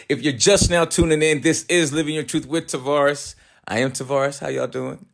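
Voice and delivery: bright upbeat show host voice; radio host intro. Acted.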